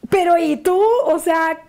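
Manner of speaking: retold disbelief. Retelling her reaction to the ex's wish, in high disbelief. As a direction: ask it like you can't believe it, voice up. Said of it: "Y yo".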